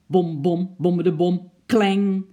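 loud rhythmic bom-bom ending in a clang; imitated thumping clatter. A narrator's reading. Storyteller imitating noises from the attic, punchy thumps and clang. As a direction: bang out the noises with your voice.

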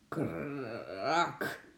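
long rolled creak ending in a crack; imitated cracking. A narrator's reading. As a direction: growl out the tree breaking.

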